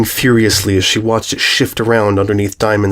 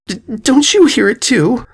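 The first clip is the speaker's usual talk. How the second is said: frightened; 13 semitones higher than usual. Acted. Terrified filly.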